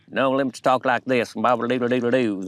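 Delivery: put-on Southern accent. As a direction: put on a hammed-up Southern twang. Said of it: "that kind of accent".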